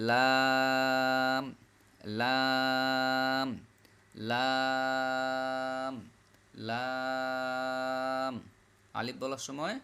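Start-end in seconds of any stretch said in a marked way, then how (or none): 0.1-8.3 লাম with the vowel held long on one level note; long, lips closing. Shown as a demonstration. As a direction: draw out লাম and close both lips on a hummed m. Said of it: "লম্বাও হবে".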